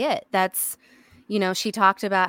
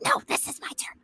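hushed, possessive whisper; whispered retort; 5 dB quieter than usual. Retold or imitated. Whispering to her friend Sarah to back off, hushed and possessive. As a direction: mouth it low and protective.

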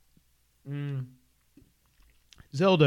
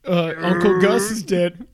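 imitating a nervous mourner, higher and slow; loud, hammed-up nervous grief; Robert; 6 semitones higher, 6 dB louder, slower than usual. Imitating him at the funeral, mock nervous. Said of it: "when he gets nervous and he makes the noise".

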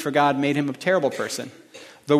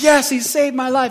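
a lightly raised, keen answer; eager reply; 10 semitones higher, 4 dB louder than usual. Retold or imitated. Himself answering the preacher, lightly raised and keen. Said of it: "And I'm like".

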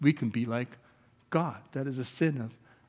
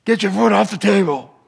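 retold angry order; 5 semitones higher, 11 dB louder than usual. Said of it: "he went like".

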